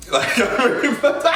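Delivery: cracking up mid-sentence; words collapse into high laughter; real helpless laughing, voice climbing; about to cry laughing. Real, not acted. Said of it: "really about to cry".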